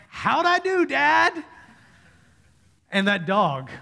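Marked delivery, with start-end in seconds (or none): 0.1-1.4 very high, put-on little baby voice; baby son. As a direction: imitate a baby eager for dad's approval. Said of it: "he turns back at me and goes".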